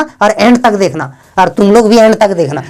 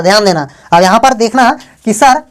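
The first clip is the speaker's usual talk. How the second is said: usual speaking voice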